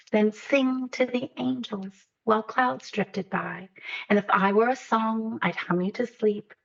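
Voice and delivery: slow, lilting verse, softening toward the close; unhurried picture-book reading with long pauses; reading a poem aloud. A narrator's reading. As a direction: read a lullaby to a loved one, lingering on phrases. Reads own picture book aloud.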